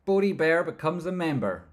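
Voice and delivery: loud, high-pitched shout-out; high, booming member announcement; excited announcement. Real, not acted. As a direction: announce it to the whole crowd.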